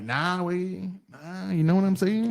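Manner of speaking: lightly put-on street slang voice; mock dumb talk. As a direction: pander with a hint of fake street talk. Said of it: "just talking all dumb".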